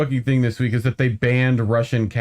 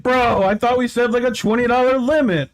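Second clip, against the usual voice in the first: whiny wise man; 9 semitones higher than usual. Retold or imitated. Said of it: "They were definitely like".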